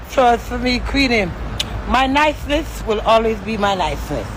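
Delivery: ordinary talk, nothing marked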